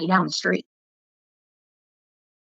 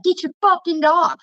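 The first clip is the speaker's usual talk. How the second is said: higher-pitched mock yell; retold angry demand; 9 semitones higher than usual. Neighbor ordering her to get the dog, retold angry demand. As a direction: imitate an irate neighbor.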